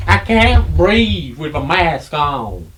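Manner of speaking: loud, drawn-out hammed-up complaint; exaggerated put-on protest; mock anti-masker whine. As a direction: wail it slowly like a suffering complainer. Joins the anti-masker act.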